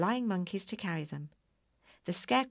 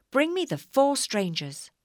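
higher, louder witch command; 7 semitones higher, 7 dB louder than usual. A narrator's reading. Witch ordering the flying monkeys, commanding. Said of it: "said the witch".

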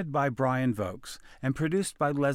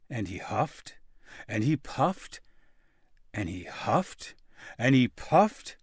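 ordinary delivery

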